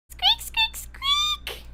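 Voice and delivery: very high, piercing squeaks; frantic mouse squeaks. Acted. Narrator voicing the mouse before it is fed to the cat, very high squeaks. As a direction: squeal like a terrified mouse, shrill and desperate. Mouse struggles weakly again.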